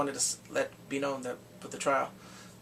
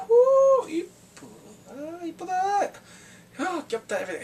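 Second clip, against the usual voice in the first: high, fluttery mock of Hannah's mom; 13 semitones higher, 6 dB louder than usual. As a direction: flap around imitating a flustered mother. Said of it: "Hannah's mom's like".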